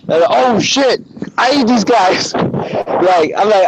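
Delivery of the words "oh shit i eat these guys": much higher, alarmed "oh shit" as it dawns on him; shocked realization. Retold or imitated. Realizing at the zoo that he eats chickens, high startled alarm. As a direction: let the horror hit all at once.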